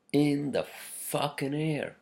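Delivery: ordinary talk, nothing marked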